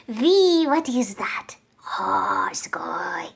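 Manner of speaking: put-on Japanese accent. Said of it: "in a Japanese accent".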